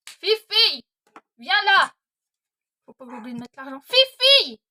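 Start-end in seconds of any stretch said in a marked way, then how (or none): 0.1-1.9 loud, high shout of the dog's name. Real, not acted. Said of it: "Désolée si je crie". 3.8-4.6 loud, high shout of the dog's name. Real, not acted. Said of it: "Désolée si je crie".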